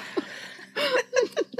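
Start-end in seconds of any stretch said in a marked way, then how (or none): 0.0-0.1 high, delighted squeal of recognition; high excited childlike gushing; giddy excitement. Retold or imitated. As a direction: gush over it like a kid who loves the book. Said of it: "I was like".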